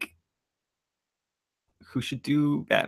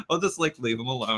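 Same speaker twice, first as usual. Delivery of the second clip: normal talking voice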